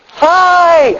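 high, loud, drawn-out squeal of hello; excited child greeting. Retold or imitated. Daycare kids hugging a stranger, greeting him excitedly. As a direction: call it out like a thrilled little kid. Acts children running up to hug.

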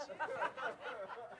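laugh. Real, not acted. Laughs at own joke.

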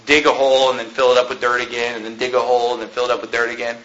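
low, soft, droning repetition; tedious monotone. Acted. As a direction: drone it like endless pointless work. Acts out pointless repetitive work.

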